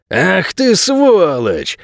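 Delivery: high, long-drawn outraged exclamation; indignant cry. A narrator's reading. Shoved lodger cursing the intruder, indignant cry. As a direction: stretch the insult out high, dropping at the end. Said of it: "вскричал Арво, не привыкший".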